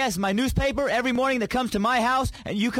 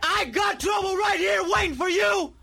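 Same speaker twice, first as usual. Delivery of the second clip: shouting; 7 semitones higher than usual. Acted. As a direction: yell it like picking a fight. Prank call escalating threats.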